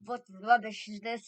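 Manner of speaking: loud, slow scornful demand; Grand High Witch. A narrator's reading. Leader to her witches, slow scornful shout. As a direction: draw it out in a commanding voice. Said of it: "shouted the Grand High Witch".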